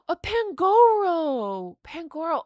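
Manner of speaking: high, slow, stretched name; delighted gasp-call. Real, not acted. Revealing a card to viewers, high awed call. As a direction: draw the name out in awe.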